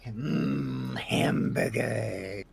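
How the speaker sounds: slow, savoring hamburger; drawn-out longing for food; dreamy hungry voice. Retold or imitated. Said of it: "Zell's over here going".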